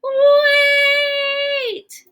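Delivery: very high, loud, wailed "Wait!"; a howling, sung cry of alarm; wailing shout. Acted. Gerald halts yet again.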